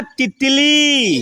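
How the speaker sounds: slow, stretched final vowel; drawn-out modelling. Shown as a demonstration. Teacher models an insect name for children to repeat, drawn out. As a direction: hold the last syllable long. चलिए बोलिए मेरे साथ.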